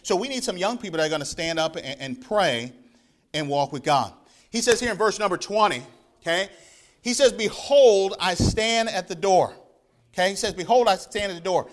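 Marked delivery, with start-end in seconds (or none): 4.5-10.5 loud, ringing preacher's voice; fervent preaching. Acted. Preacher urging his congregation, fervent and loud. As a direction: preach it out to the whole church.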